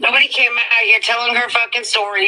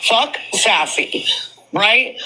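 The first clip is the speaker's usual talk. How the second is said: ordinary delivery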